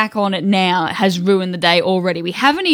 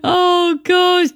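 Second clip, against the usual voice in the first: laughing exclamation; 10 semitones higher than usual. Real, not acted. Laughing at space balls.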